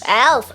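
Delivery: health said as elf; dropped H. Shown as a demonstration. Student copying coach, health with dropped H. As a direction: lose the H, end elf on f. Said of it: "don't like that H sound".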